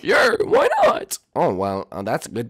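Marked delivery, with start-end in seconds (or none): 0.0-1.2 high, thin robot voice. Acted. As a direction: chirp it like a cheerful robot. Number two character voice.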